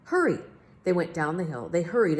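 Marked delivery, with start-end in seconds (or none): 0.1-0.4 high, loud urgent cry; urgent call. Acted. Big Max urging the king down the hill, high urgent call. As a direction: snap out the order to hurry.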